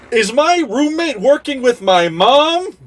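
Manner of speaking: incredulous. Acted. Shocked realization about roommate.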